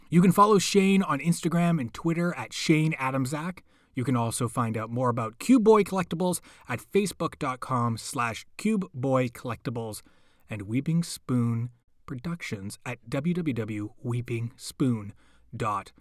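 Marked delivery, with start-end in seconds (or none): none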